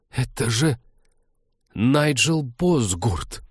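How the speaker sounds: put-on breathless, halting, slowed young voice; halting whisper. A narrator's reading. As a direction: stammer it out in nervous shock. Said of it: "сбивчиво прошептала Кира".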